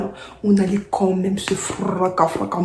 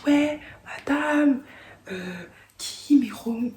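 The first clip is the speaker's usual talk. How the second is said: mock innocent girl, higher and drawn out slowly; Eve answering teacher; 6 semitones higher, much slower than usual. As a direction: play it dumb, stretching madame. Eve répond à la prof. (Eve à la prof, voix innocente aiguë et lente)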